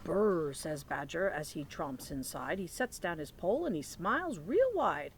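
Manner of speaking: lively picture-book read-aloud; reading to children. Acted. Teacher reading Thanksgiving book to children, lively read-aloud. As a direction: read it like story time for little ones.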